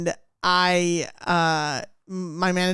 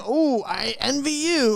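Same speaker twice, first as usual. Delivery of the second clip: slow, higher mock envy; sarcastic swoon at a manager; 6 semitones higher than usual. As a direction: drip fake admiration.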